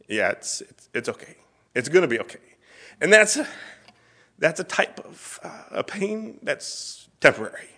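choked up, voice breaking high; weepy. Real, not acted. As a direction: fight back real tears to get it out. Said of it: "I'm a bit weepy".

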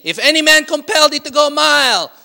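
high, loud declaration of the verse; proclaiming scripture. Acted. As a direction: ring it out like a preacher. Quoting Jesus' teaching.

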